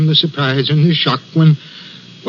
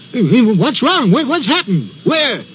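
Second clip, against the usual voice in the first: urgent calling out; 5 semitones higher than usual. Acted. Signalman running towards the figure, calling out urgently. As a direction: call after someone in alarm. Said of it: "I ran towards the figure calling".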